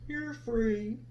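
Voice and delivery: soft, hushed lion voice; lion quietly letting Sarah go; quiet and thoughtful. Acted. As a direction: say it softly, thinking it over. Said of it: "in a voice that was quiet and thoughtful".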